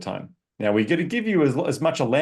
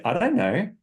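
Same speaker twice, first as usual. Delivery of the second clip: higher, cute "I don't know" rising at the end; sweet puzzled little girl with a head tilt; 7 semitones higher than usual. Retold or imitated. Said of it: "cute little, "I don't know," with an up-, upward inflection at the end".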